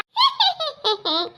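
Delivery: high witch cackle; witchy cackle. Acted. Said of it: "चुड़ैलों जैसी हंसी".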